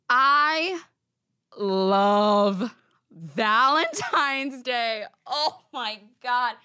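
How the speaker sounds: loud, slow, drawn-out declaration of love; gushing love. Acted. Host gushing to listeners about her favorite holiday, loud and drawn out. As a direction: stretch it out like a big gushing confession.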